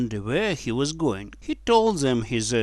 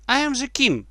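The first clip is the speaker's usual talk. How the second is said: put-on high, proud boy king; 12 semitones higher, 4 dB louder, faster than usual. A narrator's reading. Edward answers the crowd, proud high declaration. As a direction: proclaim it proudly in a much higher voice. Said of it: "said Edward proudly".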